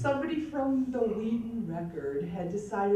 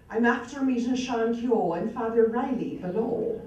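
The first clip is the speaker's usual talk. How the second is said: imitating her own stage Irish accent, a little louder; recalled Irish stage brogue; 4 dB louder than usual. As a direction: deliver the line in a practiced stage Irish accent. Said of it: "I still remember my first line".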